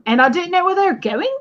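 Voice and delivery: mock Australian, every clause rising like a question; Australian upspeak. Mocking how Australians sound when telling a story, mock Australian upspeak. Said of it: "when they talk in a question".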